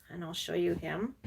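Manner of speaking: ordinary delivery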